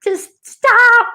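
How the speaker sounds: high, rising plea to quit; inner voice pleading. Retold or imitated. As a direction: beg in a squeaky-high inner voice. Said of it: "The other half of me was like".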